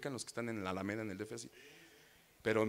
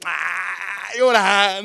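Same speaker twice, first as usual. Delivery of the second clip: indignant outburst; 5 semitones higher, 14 dB louder, much slower than usual. Retold or imitated. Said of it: "le dije".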